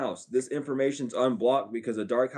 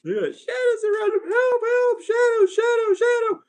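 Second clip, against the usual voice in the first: high falsetto mock panic, louder; 4 dB louder than usual. Mocking scared believers crying for help, high falsetto panic. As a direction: cry for help in a squeaky fake-scared voice.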